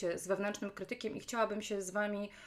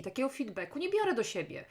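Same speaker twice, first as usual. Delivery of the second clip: usual speaking voice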